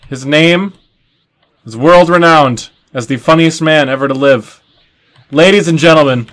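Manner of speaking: high, loud emcee introduction with big pauses; show announcer. Pretend. Emcee welcoming the breakout comedian to the stage, announcer voice. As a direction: hype up the crowd, building to the name.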